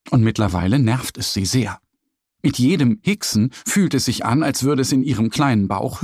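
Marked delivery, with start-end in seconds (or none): none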